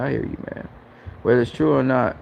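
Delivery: usual speaking voice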